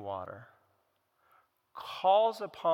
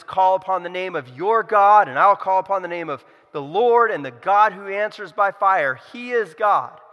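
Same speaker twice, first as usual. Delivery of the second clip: rising preaching; 3 semitones higher, 8 dB louder than usual. Acted. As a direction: press the story forward like a preacher. Quotes Elijah's challenge.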